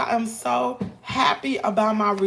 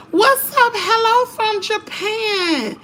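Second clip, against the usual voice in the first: high, loud, drawn-out welcome; light up greeting a viewer; excited greeting; 11 semitones higher, 6 dB louder, slower than usual. Real, not acted. Greeting live viewer from Japan.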